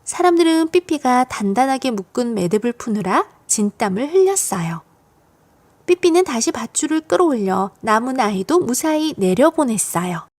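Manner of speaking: usual speaking voice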